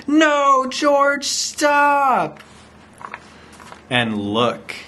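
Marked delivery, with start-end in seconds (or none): 0.1-2.4 high, drawn-out cry to stop; alarmed shout. A narrator's reading. The lady to runaway George, alarmed shout. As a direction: call out urgently in a high voice.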